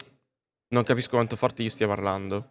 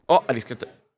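ordinary talk

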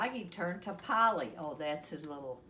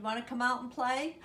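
higher, louder call to come out; shouted invitation; 6 semitones higher, 5 dB louder than usual. A narrator's reading. Froggy asks Max out to play, shouted invitation. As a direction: call it up to a friend's window. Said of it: "he shouted".

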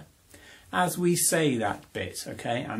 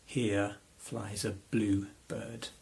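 slow, softer, spaced-out words in rhythm; slow rhythmic speech; 3 semitones lower, 6 dB quieter, much slower than usual. Shown as a demonstration. Teacher wondering which rhythm words fit, slow soft chant. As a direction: say it slowly, beat by beat. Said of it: "which ones we would need for".

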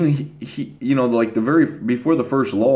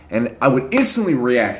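usual speaking voice

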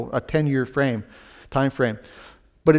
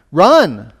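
urgent command; 9 dB louder than usual. Retold or imitated. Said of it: "Jesus gave a warning".

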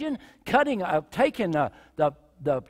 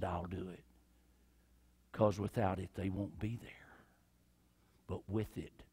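lower, much softer, near whisper; hushed resolve; 11 semitones lower, 12 dB quieter than usual. Acted. Jesus quietly resolving to go to the cross, hushed.

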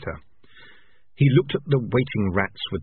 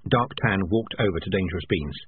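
usual speaking voice

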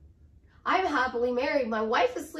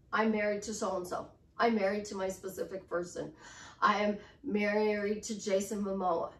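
low, matter-of-fact declaration; just say it, lower and softer, as settled fact; simply stated; 5 semitones lower, 4 dB quieter than usual. Shown as a demonstration. Coach demonstrates saying you are married, understated and matter-of-fact. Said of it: "Just say it".

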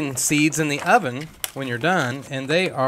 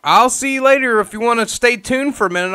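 cheerful goodbye; 7 semitones higher, 6 dB louder than usual. Real, not acted. Host calling out goodbye.

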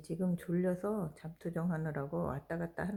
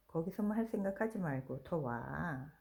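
normal talking voice

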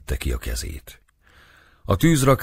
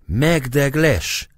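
much higher, slow furious cry; 10 semitones higher, 4 dB louder, much slower than usual. A narrator's reading. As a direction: spit it out in rage. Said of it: "szólt düben forgó szemmel a cigány".

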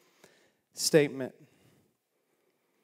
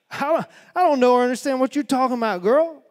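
fast, high, loud flustered denial; panicked denial; 9 semitones higher, 11 dB louder, faster than usual. Acted. Peter denying to the servant girl, fast panicked. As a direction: blurt it out in a panic.

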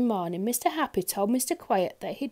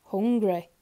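ordinary delivery

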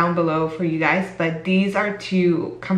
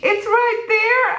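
much higher, slow, shouted with glee; excited shout; slower than usual. Real, not acted. Vlogger pointing at her photo on Sephora's page, shouting with joy. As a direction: yell it out, can't believe it.

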